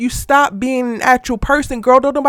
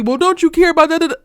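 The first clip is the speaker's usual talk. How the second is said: higher-pitched mimicked appeal; mock pleading; 6 semitones higher than usual. Mimicking the mistress running up on Chelsea, mock pleading. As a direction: pitch it up to imitate a pushy plea.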